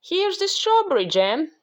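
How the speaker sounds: high storybook call; shouted. A narrator's reading. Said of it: "shouted Jack".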